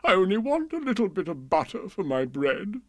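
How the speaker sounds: hammed-up plaintive king; whimpering. A narrator's reading. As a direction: plead your case, sorry for yourself. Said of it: "he whimpered".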